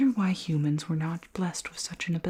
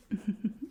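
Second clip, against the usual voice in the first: small, higher-pitched titter; soft playful chuckle; teasing giggle; 4 semitones higher, 4 dB quieter than usual. Acted. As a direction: giggle close to the ear. Teasing the human's ear.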